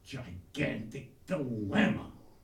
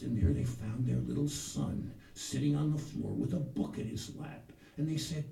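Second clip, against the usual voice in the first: usual speaking voice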